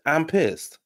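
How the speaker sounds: high-pitched imitated complaint; mock pissed-off. Host repeating her confessional line, mock pissed off.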